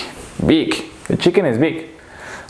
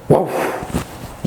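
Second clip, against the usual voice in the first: breath-heavy woof; dog woof. Pretend. Teacher showing what dogs do, dog woof. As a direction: huff out a bark.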